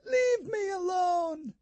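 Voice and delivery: high, slow, pleading shout; a cornered girl begging through the door; frightened shout. Acted. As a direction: cry out for them to go away. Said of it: "she said".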